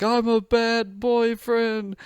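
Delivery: high, slow blubbering self-pity; mock sobbing. Drunk guy sobbing to his friend in the car, high wailing. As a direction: whine it out like a drunk crying wreck. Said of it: "sitting there in the car sobbing".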